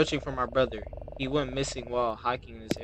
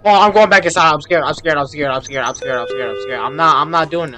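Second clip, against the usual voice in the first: louder, repeating I'm scared; real fright; 2 semitones higher, 11 dB louder than usual. Real, not acted. Gamer refusing to leave the store, scared and loud.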